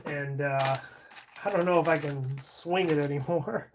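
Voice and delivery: ordinary talk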